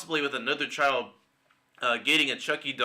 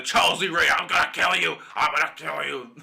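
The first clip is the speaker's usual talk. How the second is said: loud, hammed-up killer doll threat; mock menacing yell, easing off on the repeat; Charles Lee Ray; 4 semitones higher, 5 dB louder than usual. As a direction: belt out a bad villain imitation, higher than usual. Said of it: "I'm horrible at doing both voices".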